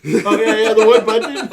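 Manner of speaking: mocking, loud and laughing; sarcastic laughing. Real, not acted. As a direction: tease him through laughter.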